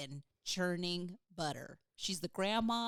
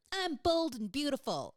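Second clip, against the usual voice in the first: high, loud mock-confident declaration; hammed-up positive self-talk, pitched up; mock self-affirmation; 6 semitones higher, 5 dB louder than usual. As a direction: proclaim it like an over-the-top affirmation. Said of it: "not gonna be like".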